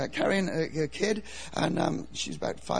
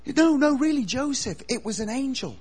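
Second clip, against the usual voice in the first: higher-pitched pleading protest; earnest insistence; 7 semitones higher, 3 dB louder than usual. Acted. Mary insisting to Joseph it was an angel, pleading. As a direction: insist on it, starting loud and high.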